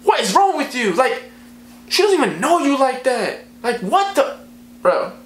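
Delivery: high-pitched incredulous scolding; squeaky disbelief, broken by pauses; exasperated. Retold or imitated. Narrator confronting Dave over a girl, high exasperated rant. Acted exasperation at crying friend.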